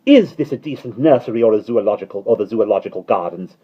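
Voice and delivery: loud, cross inquiry; angry. A narrator's reading. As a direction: demand it like an angry nanny. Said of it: "she inquired angrily".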